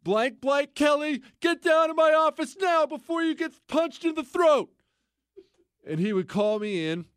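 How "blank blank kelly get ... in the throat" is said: imitating a screaming gunny, high and loud; screaming drill sergeant. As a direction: bark it out like a furious sergeant who is trying not to laugh. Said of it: "I can't say what he would say".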